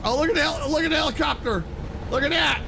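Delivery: high, eager pointing-out; excited, pitched well up; excited spotting. Real, not acted. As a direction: call out a sudden sighting. Spots a helicopter during the ride.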